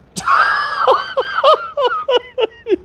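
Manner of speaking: helpless high laughter; real laughing fit. Real, not acted. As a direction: crack up laughing, long and high. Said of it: "I'm laughing".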